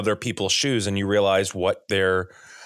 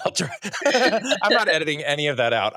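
amused self-mockery through laughter; giggly, embarrassed protest at yourself; laughing; 6 semitones higher, faster than usual. Real, not acted. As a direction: laugh at your own slip, higher pitched. Laughing at own accidental question.

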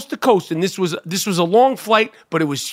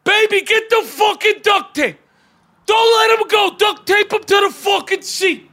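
Aiello impression; 13 semitones higher than usual. Aiello on the plane yelling at the door grabber, furious shouting. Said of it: "what would have Aiello said?".

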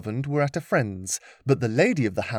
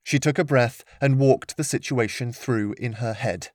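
ordinary delivery, nothing marked

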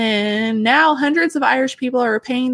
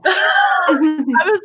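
high, louder laugh with a catch of tears; teary laugh; 7 semitones higher, 4 dB louder than usual. Real, not acted. Host recalling her tears, laughing and teary. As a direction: laugh while choking up. Retelling crying over news.

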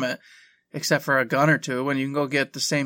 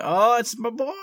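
excited; 9 semitones higher, slower than usual. Retold or imitated. Drunk excited greeting retold.

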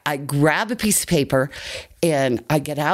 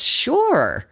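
lightly voiced [name]; 9 semitones higher than usual. [name] answers the brother.

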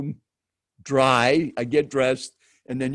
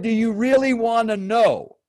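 higher, forceful imitation of a challenging seatmate; mock-confrontational challenge, pitched up; stranger's confrontational tone; 7 semitones higher, 4 dB louder than usual. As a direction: throw down the question as a dare. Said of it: "in that tone of voice".

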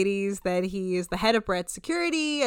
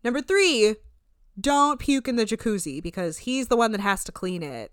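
usual speaking voice

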